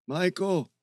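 high, pained call. Acted. Bear's voice out in the forest, calling out. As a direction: cry the name from far off. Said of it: "Bear's deep voice resonated".

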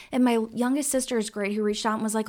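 ordinary talk, nothing marked

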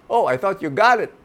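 high, loud coaxing bird. A narrator's reading. Bird coaxes the horse toward the answer. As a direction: lead him to the answer. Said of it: "the bird said to the horse".

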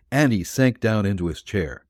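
plain reading voice

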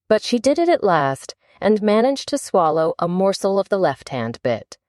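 plain reading voice

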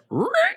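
short, very high record-scratch noise; vocal record scratch. Retold or imitated. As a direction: imitate a needle screeching off a record. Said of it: "when the record scratch happens".

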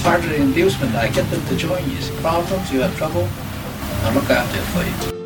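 ordinary talk

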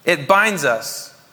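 "binds" slowed and weighted, each word apart; slow emphatic. Shown as a demonstration. Preacher repeating to the congregation, slow and weighty. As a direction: let "It binds us" sink in slowly. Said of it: "doesn't it?".

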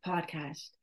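ordinary delivery

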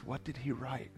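very soft, near-whispered question; hushed wondering. Retold or imitated. Crowd wonders what Jesus wrote, hushed curiosity. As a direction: wonder it aloud under your breath. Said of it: "And everybody wonders".

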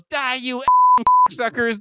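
high-pitched acted war cry; attacking yell. A narrator's reading. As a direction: hurl it out like a sudden attack. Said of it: "jumps on Huey's back and yells".

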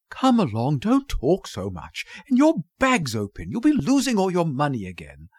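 put-on testy young girl hurrying someone along; exasperated daughter. A narrator's reading. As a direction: scold your mother with weary impatience. Daughter scolds her mother.

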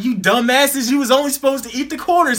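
high-pitched, quick retold telling-off; mock scolding. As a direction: act out an exasperated woman scolding the guys. Retelling woman scolding them.

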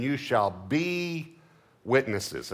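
"be" drawn out long, then "witnesses" drops; stress on be. Shown as a demonstration. Preacher highlighting a word of scripture, stress on "be". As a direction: lean slowly on "be" and hold it. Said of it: "notice the word be".

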